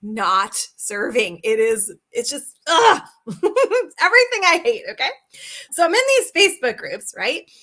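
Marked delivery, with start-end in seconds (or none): 2.1-3.0 frustrated ugh. Real, not acted. Exasperated about pushy selling.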